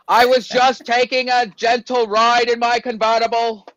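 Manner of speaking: slow, loud, high mock Kennedy drawl; Kennedy accent. Playing Kennedy for the cohost, hammed-up Kennedy accent. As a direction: orate in an exaggerated Massachusetts Kennedy accent. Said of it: "the Kennedy accent".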